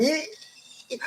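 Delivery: a very high, thin "ee" of fright; mock frightened shriek. People spotting an Annabelle doll, mock shriek. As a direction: squeal like you saw a creepy doll. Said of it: "they're going".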